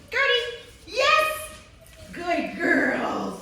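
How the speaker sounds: high, loud call and praise; excited dog praise. Real, not acted. Trainer calling puppy Gertie, high excited praise. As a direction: call the puppy, then cheer her.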